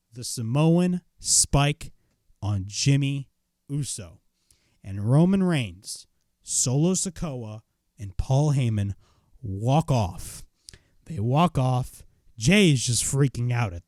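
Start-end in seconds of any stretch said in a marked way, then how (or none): none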